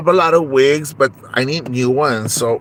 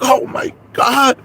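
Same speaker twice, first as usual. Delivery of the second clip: shocked cry; 11 semitones higher, slower than usual. Acted. Conchita crying out in shock at the eviction.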